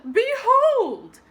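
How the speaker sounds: high, loud majestic behold; grand proclamation. Acted. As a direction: announce it to the whole hall. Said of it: "she said".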